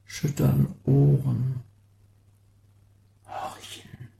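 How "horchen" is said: barely a breath on "horchen"; hushed, listening; whisper. Acted. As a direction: whisper it as if straining to hear. Sound poem, listening.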